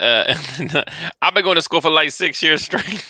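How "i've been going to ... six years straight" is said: playful high-pitched rapper impression. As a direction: mimic your co-host in a higher rapper voice. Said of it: "is it the [name] voice".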